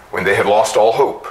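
usual speaking voice

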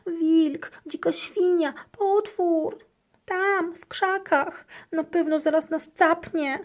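high, loud frantic cries; panicked. A narrator's reading. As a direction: babble in panic. Hamster panics about monster.

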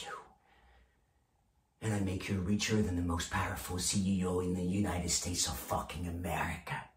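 low, soft and intense; hushed promise. Acted. Boss promises brokers riches for dialing, hushed promise.

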